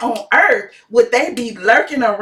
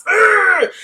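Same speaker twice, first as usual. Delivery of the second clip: mock Hulk growl; 7 semitones higher than usual. Mocking angry men, Hulk.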